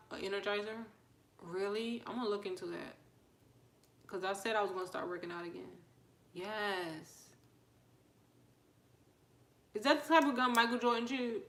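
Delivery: normal talking voice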